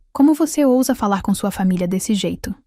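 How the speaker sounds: ordinary delivery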